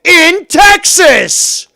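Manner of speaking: screaming, high and loud, slow and drawn out; furious scream. Real, not acted. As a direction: lose it completely, bellow every word at him.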